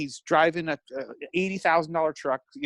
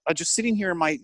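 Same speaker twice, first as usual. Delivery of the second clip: normal talking voice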